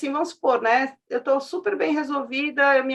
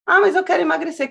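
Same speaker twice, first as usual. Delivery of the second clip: put-on higher, louder plea of a child; pleading son; 5 semitones higher, 6 dB louder, faster than usual. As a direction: voice a boy insisting he wants to slim down. Son pleads with his mother.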